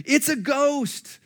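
put-on high, slow scared shriek of young men; terrified shriek. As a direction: shriek it like you've seen a ghost. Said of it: "can't you just hear their high-pitched screaming?".

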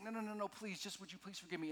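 mock pleading for mercy; retold pleading. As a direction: act out a servant begging, lightly. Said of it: "He begged".

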